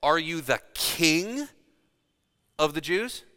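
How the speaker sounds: "king" stretched and swept upward in doubt; stress on KING. Shown as a demonstration. Pilate questioning whether he called himself king, stress on "king". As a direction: question the word "king" with a long rise. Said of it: "He could have said".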